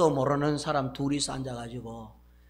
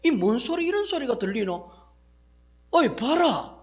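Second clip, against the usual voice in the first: higher, louder startled Gyeongsang outburst; 8 semitones higher, 5 dB louder than usual. Acted. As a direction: jolt as if hearing nonsense. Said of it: "이렇게 이제 깜짝 놀라면서". (깜짝 놀라 높게 외치듯)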